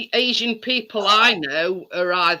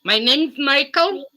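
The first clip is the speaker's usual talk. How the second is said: mock Indian scam caller, slightly raised; Indian accent; 4 semitones higher than usual. Sky scam caller on the phone, light mock Indian accent. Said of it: "it did the voice perfectly".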